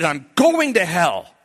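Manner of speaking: high, slow, emphatic confession; retold anguished outburst. Youth leader confessing to his pastor at the table, anguished. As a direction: blurt it out in acted anguish. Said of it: "he's banging on it like this".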